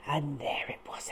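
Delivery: hushed suspenseful whisper. A narrator's reading. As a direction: whisper it as they creep up.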